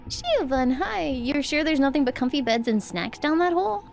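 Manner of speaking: usual speaking voice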